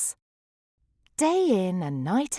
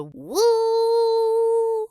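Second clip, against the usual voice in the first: high loud whoop; joyful whoop; 7 dB louder than usual. Acted. Goldie whoops into the empty canyon, loud high woo. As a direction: let out a big happy woo. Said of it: "Goldie let out a".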